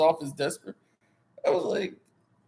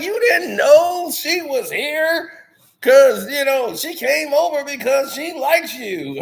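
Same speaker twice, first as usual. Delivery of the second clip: mock Dave; 7 semitones higher, 6 dB louder, slower than usual. Imitating friend Dave.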